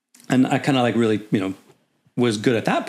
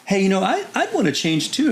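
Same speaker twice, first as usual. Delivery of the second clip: much higher, overly innocent voice; innocent ask; 11 semitones higher than usual. Retold or imitated. New lieutenant asking the clerk to switch, high and innocent. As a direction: play dumb and sweet to the clerk. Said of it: "I'm like".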